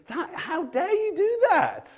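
high, slow, laughing mock indignation at God; cheeky Abraham. Preacher voices Abraham cheekily scolding God, high and laughing. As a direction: squeak it out like a cheeky boy talking back. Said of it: "he's a cheeky boy".

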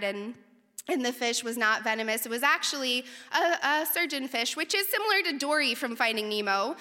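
usual speaking voice

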